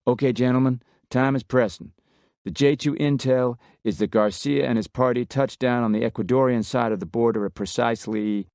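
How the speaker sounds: a lightly voiced Texan officer taking charge; Texan commander. A narrator's reading. Miller briefing the special ops team, brisk Texan voice.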